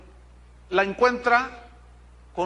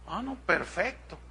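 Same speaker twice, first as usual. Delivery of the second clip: usual speaking voice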